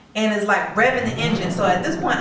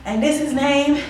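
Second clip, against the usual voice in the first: high, hurried, out-of-breath pleading; breathless and panicked; 5 semitones higher than usual. Retold or imitated. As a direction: tell the cop everything in a panic. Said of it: "I'm out of breath".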